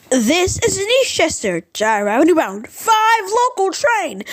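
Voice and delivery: high, announcer-style read of the stop; lightly voiced subway announcement; imitating the announcer. Vlogger imitating the new five train announcement, high announcer voice. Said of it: "the announcement now was like".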